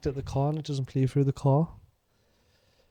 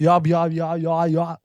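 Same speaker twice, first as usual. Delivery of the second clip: put-on higher, louder garbled old-man babble; unintelligible grandad; 5 semitones higher, 5 dB louder, slower than usual. Mimicking a grandad from a video, garbled accent. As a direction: imitate a grandad nobody can understand. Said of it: "you cannot understand what he's saying".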